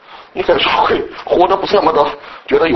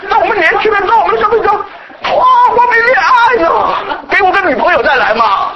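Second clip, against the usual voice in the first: mock pleading; 10 semitones higher than usual. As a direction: beg the Lord to wait for a girlfriend first. Acting out young man's plea. (年轻人夸张地恳求主晚点再来)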